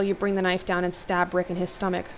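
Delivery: ordinary delivery, nothing marked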